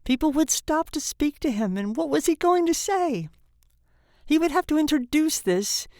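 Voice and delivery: high-pitched, fretful narration; anguished dread. A narrator's reading. Tortured father imagines introductions, anguished and high. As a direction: voice his panic, pitched high.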